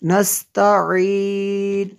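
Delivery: नस्तईन chanted with a pharyngeal ain; Tajweed नस्तईन with throat sound and held ending; correct throat ain. Shown as a demonstration. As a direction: recite नस्तईन slowly, squeeze the ain before a long ee. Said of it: "ऐसे पढ़ना है".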